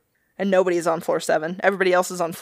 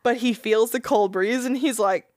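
ordinary talk

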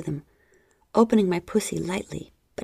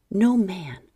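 plain reading voice

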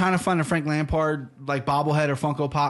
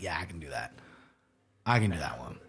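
much lower, much softer mumbled bravado; mock lazy brag; 10 semitones lower, 8 dB quieter than usual. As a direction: toss it off in a low mumble, mocking. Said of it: "I thought you were gonna probably like".

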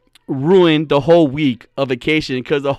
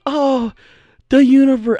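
Kiera Mia; 7 semitones higher than usual. Retold or imitated. Retrying a friend's universe talk, imperfect spacey impression. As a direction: imitate her cosmic wonder, imperfectly. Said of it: "she talks differently".

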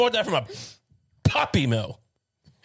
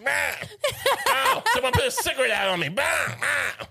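high, yelping animal cries mixed with complaints; mock whining dog; 9 semitones higher than usual. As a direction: play a wounded old dog wailing its grievances. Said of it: "She's going".

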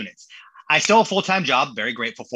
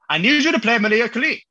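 higher and louder, imitating his wife's Mexican accent; 8 semitones higher, 4 dB louder than usual. Wife demanding her song from the DJ, in a Mexican accent. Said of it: "the impersonation is great".

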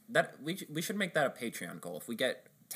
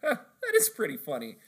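laughing customer; 9 semitones higher, 6 dB louder than usual. Retold or imitated. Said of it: "the guy just is like".

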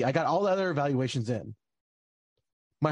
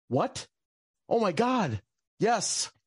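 retold elation; 6 semitones higher, much slower than usual. Relief on hearing appeal possible.

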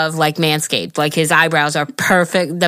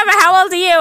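teasing question; 12 semitones higher, 5 dB louder, faster than usual. Retold or imitated. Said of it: "they're like".

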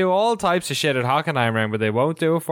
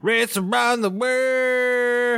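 slow, drawn-out, much higher announcer call; 12 semitones higher, much slower than usual. Pretend. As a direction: announce it like a big show title. Dramatic show title announcement.

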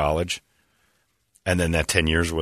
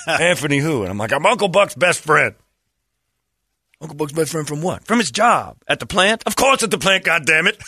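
voicing the relatives; 8 semitones higher, 3 dB louder than usual. Acted text exchange, high.

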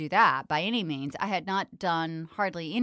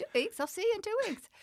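much higher, breezy promise; cheeky higher take on the same words; bright confident reply; faster than usual. Retold or imitated. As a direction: toss it off lightly, pitched way up. Replayed bold reply, higher.

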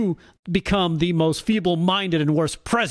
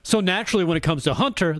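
ordinary talk, nothing marked

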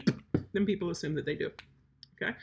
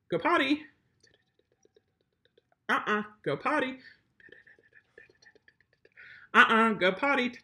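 repeated "go potty", higher at first; giving the cue; 4 semitones higher than usual. Shown as a demonstration. Trainer repeating the potty cue to the dog. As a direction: repeat the cue patiently until he goes. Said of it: "to actually put it on the cue".

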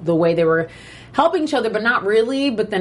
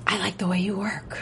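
softer, hushed quote of the line; quiet first take; 7 dB quieter than usual. Retold or imitated. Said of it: "Gretchen approached Donna ... and said".

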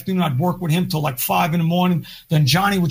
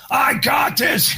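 much higher, slow, hammed-up declaration; cocky Joe; 7 semitones higher, much slower than usual. Imitating Joe brushing off help, high and drawn out. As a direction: drag it out like a swaggering guitarist. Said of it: "Joe would be like".

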